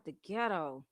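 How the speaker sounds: ordinary talk, nothing marked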